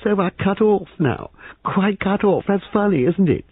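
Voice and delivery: very high, excitable voice; shrill it on the edge of hysteria; shrill, near-hysterical. A narrator's reading. Young man amused they are cut off, near-hysterical. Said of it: "a high, shrill, almost hysterical laugh".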